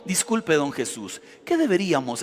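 hammed-up polite asking; mock polite request. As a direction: play the timid, respectful petitioner, mock style. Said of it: "no me imagino que haya sido como".

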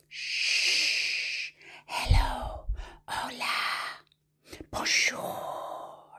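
hushed whispered greetings; as softly as possible. Shown as a demonstration. Librarian playing a hello game with babies, whisper. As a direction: whisper each word, drawn out. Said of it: "as softly as we can".

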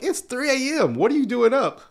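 high, amazed friends asking why he is up; mock surprise. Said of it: "They'll be like".

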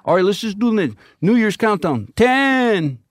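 high, loud emcee countdown; announcer counting down to New Year; countdown call. Retold or imitated. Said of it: "This is like".

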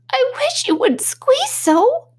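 very high, slow little Dormouse voice; squeaky Dormouse. A narrator's reading. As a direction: squeak it out like a tiny mouse.

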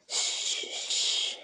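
sharp, voiceless shushing hiss; soft, urgent "shh" hissed out. A narrator's reading. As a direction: hiss two hushing shushes. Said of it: "hissed Gollum".

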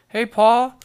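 loud, high call with a lift on Paul; demo yell. Shown as a demonstration. Showing viewers how to call his dad, loud high yell. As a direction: belt out Paul's name, rising. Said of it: "that's how you gotta say it".

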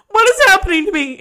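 high, loud, hammed-up panicked cry; dramatized panic. Medium panics at her own mirror reflection, dramatized panic. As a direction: wail it in mock panic.

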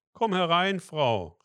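loud, high call inviting her in. A narrator's reading. Martin calling the cold mother inside, loud and high. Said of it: "rief Martin ihr zu".

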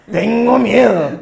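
shouted, loud panicked wail; loud scared cry. Retold or imitated. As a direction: yell it like the famous video clip. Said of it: "¿Se acuerdan de ese pinche video?".

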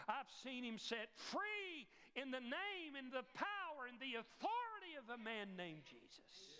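a hint of revival fervour; building preaching. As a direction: build it up, miracle after miracle. Zacchaeus recounts miracles, preaching.

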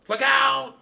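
high, loud alarmed shriek. Acted. Narrator warns Trap off his glasses, loud shriek. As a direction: shriek a sharp warning. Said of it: "I shrieked".

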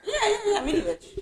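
high-pitched playful jeer; squeaky, laughing mockery; teasing squeal. Teasing about the little dog.

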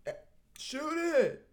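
high-pitched yelled coaching; coach yelling. Retold or imitated. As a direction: bark out orders like a courtside coach.

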